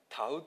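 「たう」 said slowly and clearly, Hiroshima style; Hiroshima dialect word. Shown as a demonstration. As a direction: show off the local word 「たう」. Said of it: "という方言".

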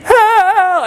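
very high, squeaky mock plea for help; weak teenage cry. Lost sixteen-year-old crying out again on the mountain, in a weak high voice. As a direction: imitate a feeble teenage call for rescue. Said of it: "in my weak little sixteen-year-old voice".

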